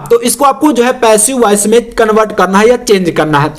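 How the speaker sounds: usual speaking voice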